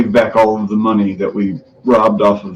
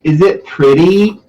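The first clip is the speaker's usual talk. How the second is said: high, louder, drawn-out question; cutesy put-on voice; 8 semitones higher, 5 dB louder, slower than usual. Player showing off the new outfit, cutesy put-on voice. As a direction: coo it slowly in a high put-on voice. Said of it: "You do that very well".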